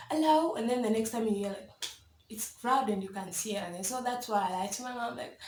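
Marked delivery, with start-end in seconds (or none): 0.1-0.6 high, loud phone greeting; answering the phone. Retold or imitated. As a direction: call out a hello as if picking up. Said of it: "you'll be like".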